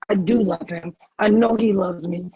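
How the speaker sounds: ordinary talk, nothing marked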